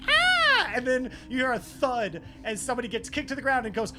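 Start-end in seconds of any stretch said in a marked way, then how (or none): 0.1-0.7 high, loud screech. Acted. Game master plays Clarissa as people burst in, screeching. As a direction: let out a piercing acted shriek. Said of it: "Clarissa screeches".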